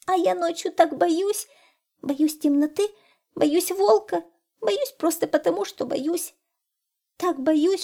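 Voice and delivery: high, loud rush of frightened words; scared hare blurting out all its fears. A narrator's reading. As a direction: pour it all out like a panicked hare. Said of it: "выпалил заяц".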